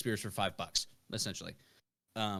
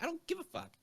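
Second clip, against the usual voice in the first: bartender; 8 semitones higher, 6 dB quieter than usual. Retold or imitated. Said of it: "They're like".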